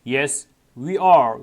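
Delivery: drawn out word by word; very slow model reading. Shown as a demonstration. Teacher modelling the answer for students, very slow. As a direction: stretch each word for learners. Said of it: "teacher models textbook answer".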